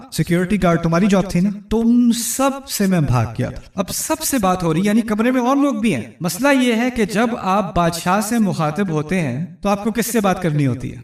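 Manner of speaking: ordinary talk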